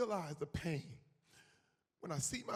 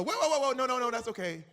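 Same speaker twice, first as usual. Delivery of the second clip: louder, higher mock whoa whoa; mock stopping; 5 semitones higher, 10 dB louder, faster than usual. Casting people halting actor's levitation attempt, loud mock alarm. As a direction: hold him back loudly, half joking.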